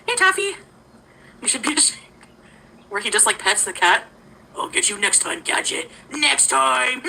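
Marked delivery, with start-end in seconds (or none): 4.6-7.0 Doctor Claw. Retold or imitated. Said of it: "I'm like Doctor Claw".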